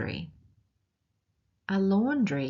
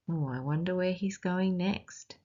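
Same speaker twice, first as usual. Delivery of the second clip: plain reading voice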